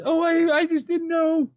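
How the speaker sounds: high, drawn-out feigned innocence; mocking a wide-eyed innocent routine; mock babe in the woods. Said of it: "the babes in the wood routine".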